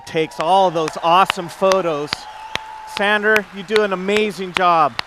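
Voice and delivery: calling out to a photographer; loud praise. Real, not acted. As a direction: call across the room with praise.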